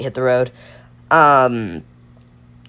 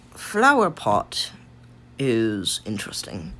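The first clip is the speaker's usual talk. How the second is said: slow, stilted, deliberate delivery; odd put-on manner; 5 dB quieter, slower than usual. Real, not acted. Player pondering where to rank a card, in an odd measured manner. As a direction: draw the words out strangely, as if pondering. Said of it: "I do not know why I'm speaking in this manner".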